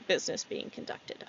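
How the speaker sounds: hushed whispered aside; whispering. Shown as a demonstration. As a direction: whisper it like an eavesdropper. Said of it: "you can definitely hear".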